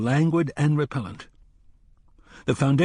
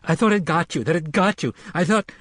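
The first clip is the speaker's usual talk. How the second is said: crying; 7 semitones higher than usual. A narrator's reading. Frightened boy running to the gunslinger, crying. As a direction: sob it out in relief.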